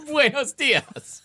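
put-on very high, slow, laughing "Buenos dias" in an Italian Spanish accent. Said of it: "your Italian Spanish accent".

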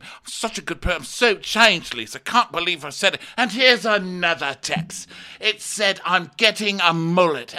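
high, loud fake remorse; hammed-up [name]. [name] to Lisa, high and loud mock remorse. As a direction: overplay the sorry act.

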